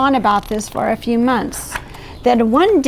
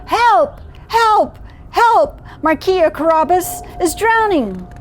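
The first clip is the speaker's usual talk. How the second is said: storybook shout for help, held back in volume; calling for help; 7 semitones higher than usual. A narrator's reading. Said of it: "shouted with all his might".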